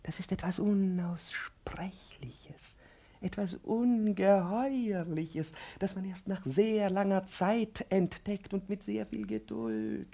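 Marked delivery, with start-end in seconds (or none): 0.0-7.3 slow, unvoiced hushed whisper; conspiratorial whisper. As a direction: whisper it like a dark secret. Professor whispers a secret.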